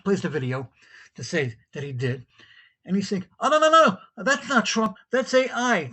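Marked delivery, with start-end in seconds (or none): 3.4-5.9 high, loud retold no no no; flustered denial. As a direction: scramble to deny the video, jumping up high and loud. Caller denies clip after hearing it.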